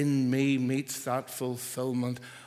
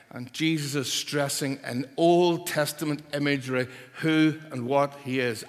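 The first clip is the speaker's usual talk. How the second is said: normal talking voice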